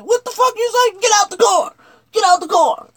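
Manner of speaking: high, loud barked commands; furious cop shouting; cop yelling. Retold or imitated. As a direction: order him out like an angry cop.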